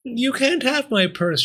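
high-pitched Pearl figure; bold young Pearl. A narrator's reading. Pearl defying armed robbers, surprised by her own boldness. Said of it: "surprised at her own boldness".

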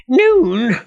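high, loud goblin screech; aghast goblin shriek. A narrator's reading. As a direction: screech it in disbelief. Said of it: "shrieked the goblin".

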